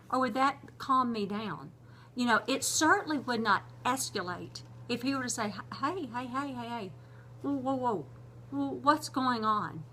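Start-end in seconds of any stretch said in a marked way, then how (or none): 5.8-9.8 soft, soothing hey, hey, whoa; calming, not yelling. Retold or imitated. Husband calming his upset wife, soft and soothing. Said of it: "instead of yelling".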